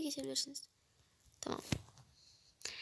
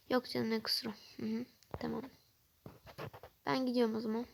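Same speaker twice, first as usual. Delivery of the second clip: ordinary talk, nothing marked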